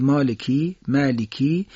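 مالکی ending in a stretched Arabic i. Shown as a demonstration. Teacher showing what Arabic vowel does, stretched i. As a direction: draw out the final i of مالکی. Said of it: "اگر عربی بگید".

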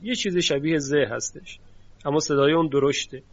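ordinary delivery

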